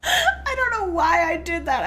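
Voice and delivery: laughing to tears; teary laugh. Real, not acted. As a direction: speak through happy tears. Crying with laughter.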